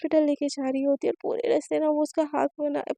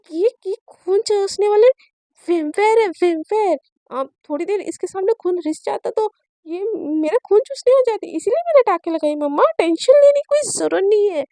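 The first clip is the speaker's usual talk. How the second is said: excited teasing; 5 semitones higher, 9 dB louder than usual. Acted. Teasing sister as vampire.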